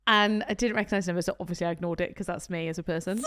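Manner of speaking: normal talking voice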